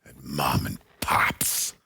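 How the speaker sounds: slow, low, breathy dramatic whisper. Shown as a demonstration. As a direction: hush it like a movie trailer. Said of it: "Do you want a dramat".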